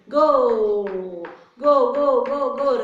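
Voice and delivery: loud, repeated urging cry; starting shout. Acted. Teacher sets the story's race going, loud urging shout. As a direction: send the racers off with a big call.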